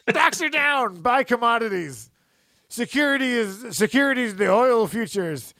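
high-pitched mock TV market yelling; Jim Cramer yelling. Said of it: "he just yells at the thing".

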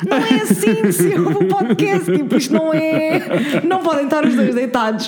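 telling off parents for lying in bed, high; scolding parents. Retold or imitated. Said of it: "fiquei tipo".

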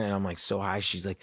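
normal talking voice

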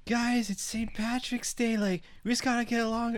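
put-on high, dragged-out drunken plea; super wasted guy. Wasted man pleads with two arguing strangers, mock drunken whine. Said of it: "like, super wasted".

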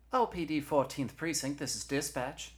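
high-pitched official greeting; police dispatcher. Acted. Dispatcher picks up Eugenia's call, high and official. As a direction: answer the switchboard, pitch well up.

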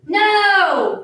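loud, high, drawn-out refusal; children shouting. A narrator's reading. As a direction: shout it like a group of kids. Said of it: "shouted the children".

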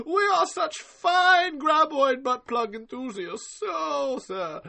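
high, slow, posh put-on voice; snooty collector. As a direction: ham up a pompous aristocrat, drawing it out. Acting snooty collectors at wine party.

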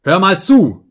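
loud, high demand for attention; sharp furious outburst; angry shout. A narrator's reading. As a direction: yell it right at the servant. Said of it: "schrie ich ihn an".

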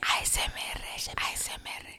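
soft, voiceless whisper into the mic; ASMR whisper. Shown as a demonstration. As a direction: whisper each letter, playing at ASMR. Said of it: "hay que hablar bajito". (susurro de ASMR)

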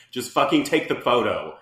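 loud, fed-up outburst with a laugh; exasperated snap. Retold or imitated. Retelling the photo shoot, a loud laughing exasperated snap. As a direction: bark it out, half laughing. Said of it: "And I was like".

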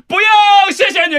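loud high no thanks; shouting. Retold or imitated. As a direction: shout it to cut the caller off. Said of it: "我就立刻大喊说".

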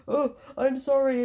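high, slow, loud mock apology; simpering apology. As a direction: mimic a grovelling simp, drawn out. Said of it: "being like a total fucking simp".

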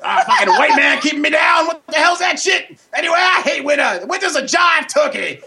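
put-on high, shouting comic old-timer bit. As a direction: bellow it as a rowdy comic character. Host plays his loud character Pops.

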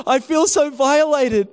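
high, loud mock-wounded cry; hammed-up indignation; worked up. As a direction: imitate a distraught victim's complaint. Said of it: "He was all worked up".

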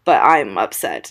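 ordinary talk, nothing marked

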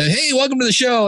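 high, upbeat show greeting. Shown as a demonstration. Host demonstrating his show opening, upbeat and high. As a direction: lift it brightly for the opener. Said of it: "I'm a little more up".

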